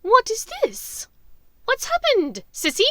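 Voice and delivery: a witch's voice that rings out, high and loud; Bellatrix. Acted. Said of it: "the voice from his nightmares rang out".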